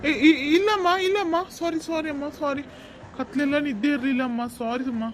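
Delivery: high, loud flustered sorry; startled apology. Acted. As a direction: jolt awake and stammer apologies. Driver jolted awake, apologizing.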